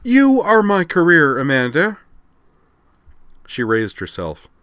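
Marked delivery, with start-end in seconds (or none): 0.0-2.0 impassioned outburst. A narrator's reading. Rena confronts her friend Amanda, impassioned and loud. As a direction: declare it fiercely, drawing it out.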